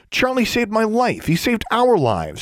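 very high, emphatic protest; insistent girl. A narrator's reading. Girl defending the bird who saved them, insistent. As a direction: insist in a high girl's voice, slowing at the end.

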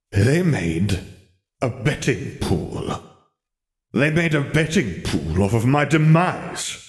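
slow, appalled muttering; disbelieving. Acted. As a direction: spell out the outrage slowly. Lennon upset, disbelieving.

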